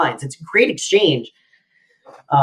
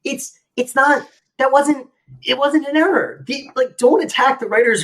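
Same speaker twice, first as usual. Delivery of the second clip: much higher, exasperated protest; 9 semitones higher than usual. Real, not acted. Host ranting at an IMDb commenter, exasperated and higher. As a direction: throw up your hands at the nonsense.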